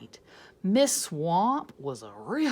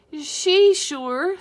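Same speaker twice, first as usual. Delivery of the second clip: put-on much higher, louder kids' voice; 10 semitones higher, 11 dB louder than usual. Kids answering for the teacher, high and loud. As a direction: chirp it like eager kids.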